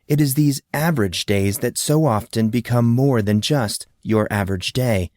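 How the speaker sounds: plain reading voice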